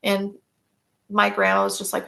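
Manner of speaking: ordinary talk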